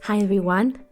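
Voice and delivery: ordinary talk, nothing marked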